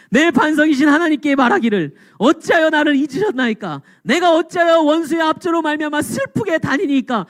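high, anguished plea in the psalmist's voice; acted outcry of a resentful prayer; crying out to God. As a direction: cry out in complaint to God, pitch climbing. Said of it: "막 소리 지르는 거예요".

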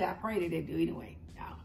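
quick hushed whisper; whispered aside. Real, not acted. Whispering an aside to viewers about her eyebrows. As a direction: breathe it as a private prayer.

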